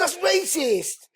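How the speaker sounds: high, stretched-out comic accusation; slow, squealed-up indignant retort; mock outrage. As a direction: draw it out in high mock offence. Comic retold retort to the girl.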